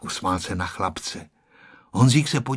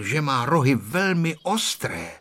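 ordinary delivery

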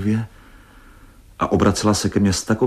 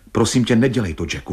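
usual speaking voice

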